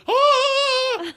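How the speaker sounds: retold panic shriek in a theatre; panicked scream. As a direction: shriek like you're freaking out. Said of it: "And I start freaking out".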